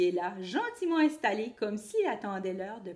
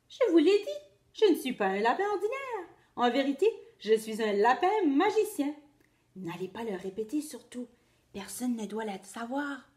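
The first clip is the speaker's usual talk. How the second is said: squeaky rabbit; 3 semitones higher, 4 dB louder than usual. Acted. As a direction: confide the secret playfully. Rabbit reveals secret.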